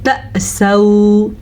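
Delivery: तअसौ with a sharp catch on the hamza; slow demo of the hamza jolt; glottal stop demo. Shown as a demonstration. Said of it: "कैसे?".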